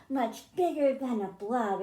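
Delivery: ordinary delivery, nothing marked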